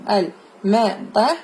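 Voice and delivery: slow model reading. Shown as a demonstration. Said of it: "slow model reading".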